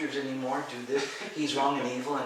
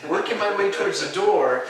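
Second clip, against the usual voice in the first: agitated; 8 semitones higher, 13 dB louder than usual. Retold or imitated. Retelling fleeing in terror.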